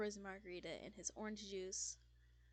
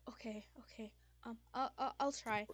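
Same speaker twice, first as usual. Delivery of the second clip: hushed okays into a stuttered promise; nervous; 2 semitones higher, 4 dB louder than usual. Acted. Fisk agreeing before fleeing the bar, nervous stammer. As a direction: stammer uneasily through agreeing. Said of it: "nervously shaking a bit".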